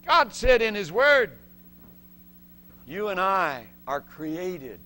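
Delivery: loud, high, slow declaring; preacher driving home the message; preaching at full force. Real, not acted. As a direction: proclaim it to the congregation.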